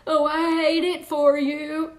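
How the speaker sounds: slow, sugary mock sympathy; smug sarcastic. Shown as a demonstration. Mother to child, smug and sarcastic. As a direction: fake pity dripping with sarcasm. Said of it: "smug, sarcastic".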